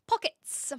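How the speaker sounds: "Pockets" breathed out high, almost whispered; her way of saying pockets. Shown as a demonstration. Explaining to chat how she says pockets, airy and high. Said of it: "that is how I say pockets".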